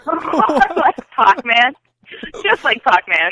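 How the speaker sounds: loud, teasing "POCK-man" for "Pac-Man"; mocking mispronunciation. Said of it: "I don't know if I'm getting worse with pronouncing things".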